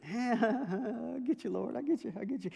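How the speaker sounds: high, laughing surrender; laughing to God. Retold or imitated. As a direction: laugh as you give in to God.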